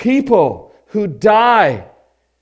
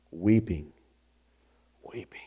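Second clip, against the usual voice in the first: hushed; 11 semitones lower, 11 dB quieter than usual. Real, not acted. Preacher naming weeping, hushed.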